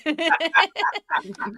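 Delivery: high, loud laugh; burst of laughter. Real, not acted. Listener amused by the rapper's phrase, laughing loudly. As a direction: crack up at a silly phrase.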